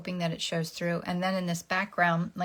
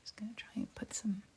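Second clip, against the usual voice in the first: whispering; 3 semitones higher, 11 dB quieter than usual. Real, not acted. Whispered aside.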